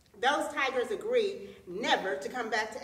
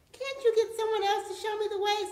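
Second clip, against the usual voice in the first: high, loud angry tiger; exasperated, raised-voice complaint; 10 semitones higher, 5 dB louder than usual. A narrator's reading. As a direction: demand it crossly, voice pitched up. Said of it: "said the tiger angrily".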